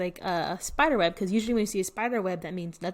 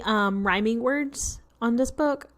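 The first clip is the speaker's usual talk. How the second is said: normal talking voice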